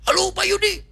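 high-pitched, agitated phone greeting; panicked caller. Acted. Congregant phoning the pastor for help, panicked.

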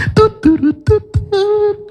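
high beeping tut tut sounds; phone beep imitation. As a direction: mimic a phone line tone. Mimicking phone tone during discussion.

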